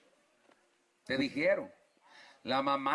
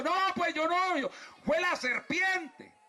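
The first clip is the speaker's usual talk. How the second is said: high, slow flustered denial; Eva pushing the blame onto the serpent; flustered excuse; 11 semitones higher, slower than usual. Retold or imitated. Eve blaming the serpent. (Eva excusándose, aguda y nerviosa)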